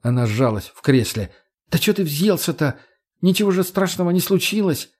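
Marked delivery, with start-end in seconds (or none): 1.7-4.9 high, defensive woman's voice; high-voiced, aggrieved protest. A narrator's reading. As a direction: push back in a pitched-up whine. Margo protests to angry Kochetkov.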